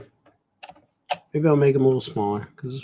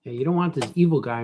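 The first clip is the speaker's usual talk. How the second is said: ordinary talk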